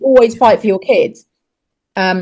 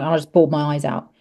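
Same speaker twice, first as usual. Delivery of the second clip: ordinary delivery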